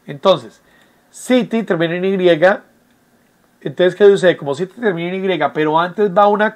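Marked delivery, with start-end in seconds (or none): none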